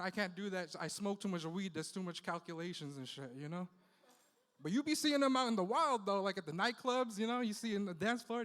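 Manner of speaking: ordinary delivery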